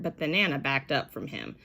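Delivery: plain reading voice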